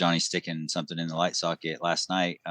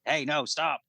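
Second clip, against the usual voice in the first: a hint of a sharp high warning call; retold warning yell; 9 semitones higher, slower than usual. Dad calling his daughter to stop, mild retold yell. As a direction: cut her off mid-run, pitched higher. Stopping daughter near swing.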